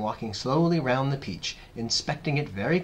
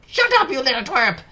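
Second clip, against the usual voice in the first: high, loud angry snap; Aunt Spiker snapping; 10 dB louder than usual. A narrator's reading. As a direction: snap sharply at a child. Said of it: "Aunt Spiker snapped".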